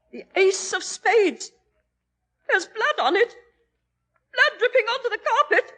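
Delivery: rising to a high, loud shriek; horrified scream. Acted. Mother seeing blood, building to a terrified scream.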